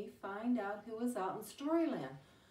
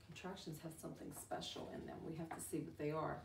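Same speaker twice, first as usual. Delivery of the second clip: whispered secret; 8 semitones lower, 11 dB quieter, much faster than usual. Acted. Teacher shares a secret.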